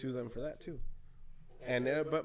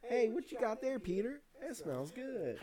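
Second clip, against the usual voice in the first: high-pitched cartoon neighbor impression; Cleveland; 9 semitones higher, slower than usual. Friend retelling a Family Guy scene, high cartoon impression. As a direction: attempt a high, singsong cartoon voice. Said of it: "I can't do Cleveland".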